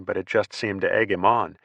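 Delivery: plain reading voice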